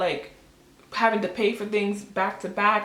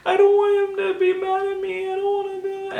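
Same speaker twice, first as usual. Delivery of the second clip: high wailing, acted out; mock sobbing, squeaky with panic; retold crying; 11 semitones higher than usual. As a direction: re-enact a tearful hysterical whine. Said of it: "I'm still crying".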